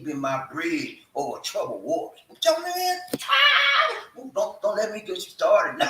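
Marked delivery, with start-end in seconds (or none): 3.2-4.2 sudden high shout of joy; excited cry. Real, not acted. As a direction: let out a real joyful cry. Excited while preaching.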